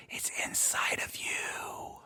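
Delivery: breathy hushed reveal; whisper. Acted. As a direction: drop to a whisper. Preacher's dramatic reveal.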